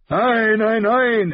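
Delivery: high, loud booming refusal; boisterous king. A narrator's reading. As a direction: bellow it like an impatient king. Voices King Robert. (polternder, lauter König)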